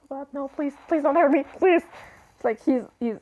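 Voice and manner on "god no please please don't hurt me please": scared begging, voice rising; desperate cracking pleading. Retold or imitated. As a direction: plead like a frightened puppy. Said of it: "he's just".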